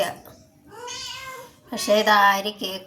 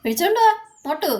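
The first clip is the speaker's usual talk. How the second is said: calling out; 9 semitones higher, faster than usual. Real, not acted. Calling someone to bring the cat.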